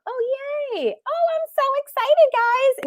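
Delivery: high, thrilled squeal of joy; real excitement. Real, not acted. Host thrilled as students sign up, excited high squeal. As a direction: gush with delight at the sign-ups.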